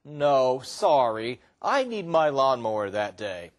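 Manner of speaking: low, gentle polite no; calm, regretful refusal; apologetic refusal. Shown as a demonstration. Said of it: "my friend could have said".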